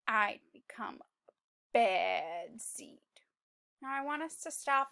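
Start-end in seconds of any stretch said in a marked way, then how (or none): 0.1-3.0 story narration. A narrator's reading. Teacher reading the seed's change to children, hushed narration. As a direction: read it darkly to the kids.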